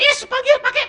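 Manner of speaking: laughing through the quoted words; can't help chuckling as you quote him. Retold or imitated. Laughing while retelling the scene. (tertawa sambil menirukan)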